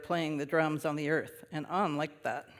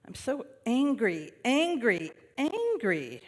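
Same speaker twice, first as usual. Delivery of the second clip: put-on angry child; 6 semitones higher, slower than usual.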